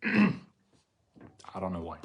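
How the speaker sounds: real tears, slow and choked; fighting back tears, slowly. Real, not acted. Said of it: "wipe the tears".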